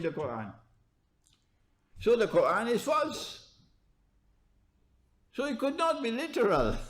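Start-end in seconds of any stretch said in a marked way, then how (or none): 5.3-6.9 laughing. Real, not acted. Mocking the literal reading.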